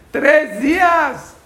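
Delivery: high, loud, drawn-out disbelief; slow, high outcry at how quickly they forgot; incredulous exclamation. Real, not acted. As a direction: stretch it out in shocked disbelief. Preacher's outraged punchline.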